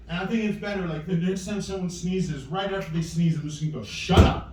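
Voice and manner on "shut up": sudden short burst, lightly acted; a touch of comic abruptness; mock snapped retort. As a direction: cut in with a mock outburst.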